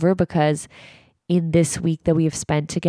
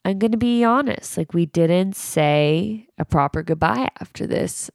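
ordinary talk, nothing marked

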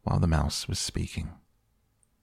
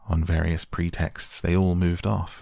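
usual speaking voice

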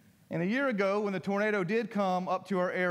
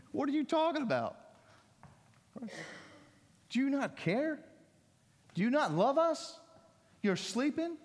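higher, pleading mock-child complaint; whiny kid accusation; 8 semitones higher than usual. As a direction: act out a child accusing dad, voice up high. Kids' whiny accusation voiced.

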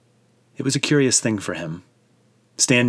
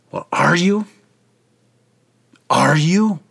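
higher, louder, badgering high priest; impatient demand; 8 semitones higher, 5 dB louder than usual. A narrator's reading. High priest badgers the silent Jesus, louder and higher. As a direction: push him hard for an answer.

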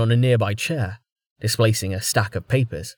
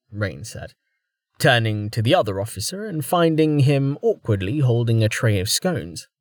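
plain reading voice

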